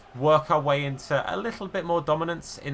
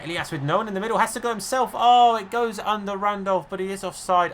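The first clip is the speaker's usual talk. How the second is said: higher and louder, rising to a cry; thrilled live call, cut short; excited commentary; 8 semitones higher, 6 dB louder than usual. Real, not acted. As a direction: call the chance as it goes in, then deflate at the flag. Live chance, goal then offside.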